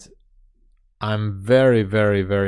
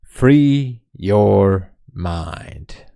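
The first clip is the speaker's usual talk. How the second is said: very slow, loud, grave Morpheus; slow oracle voice, louder; Morpheus impression; 5 dB louder, much slower than usual. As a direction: intone it like Morpheus offering the pill. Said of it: "like Morpheus in The Matrix".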